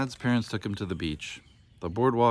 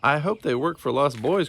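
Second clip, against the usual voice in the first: higher, louder pleading cry; beg, close to tears; tearful plea; 5 semitones higher, 4 dB louder than usual. A narrator's reading. Lost boy cries out his hope to Booney, tearful. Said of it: "cried Tad".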